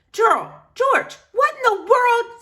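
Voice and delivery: high, loud startled cry; wife shocked at her husband's state; alarmed wife. Acted. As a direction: gasp it at the battered husband.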